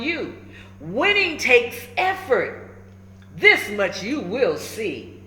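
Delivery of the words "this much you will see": usual speaking voice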